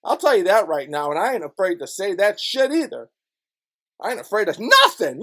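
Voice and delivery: normal talking voice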